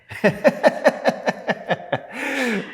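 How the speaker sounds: high, drawn-out laugh; long burst of high-pitched laughter; real laugh. Real, not acted. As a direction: laugh at your own stubbornness. Laughs at own hard-headedness.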